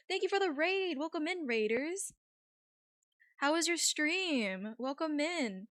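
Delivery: high, sweet streamer greeting voice; chirpy cute welcome for the raiders; cute anime streamer. Acted. Welcoming raiders, introducing herself.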